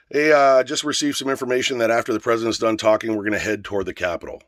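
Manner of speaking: calling out loudly, then settling down; retold announcement to a crowd; mic announcement. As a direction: open loud as if addressing a crowd, ease off by the end. Retells his announcement on the mic.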